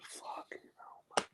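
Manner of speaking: breathy under-the-breath whisper; whispered curse. Retold or imitated. Agent seeing the rival trio, whispers a curse to himself. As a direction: whisper it so nobody hears.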